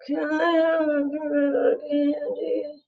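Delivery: put-on high, slow, drawn-out kid whine; whiny kid. Kid begs parent for candy, whiny. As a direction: stretch every word into a soft, pleading whine. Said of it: "because the whining is this".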